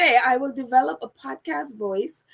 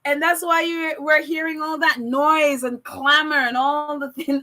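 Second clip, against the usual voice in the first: usual speaking voice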